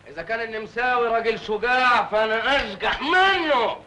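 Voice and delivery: ordinary delivery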